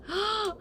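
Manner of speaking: high, sudden gasp; dramatic intake of breath; excited gasp. Pretend. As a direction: gasp in mock amazement. Delighted at gaining dexterity.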